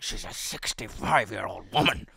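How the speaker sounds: slow, outraged telling-off; mock anger, every word stretched out; angry teacher. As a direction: imitate a teacher's stern, drawn-out reprimand. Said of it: "then he got angry".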